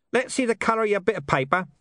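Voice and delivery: high, quick demand; commanding hidden speaker. A narrator's reading. Hidden speaker demands to see the cheque, commanding. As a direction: order it briskly in a high character voice. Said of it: "commanded the voice of Deep Bone".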